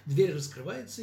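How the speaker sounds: ordinary delivery, nothing marked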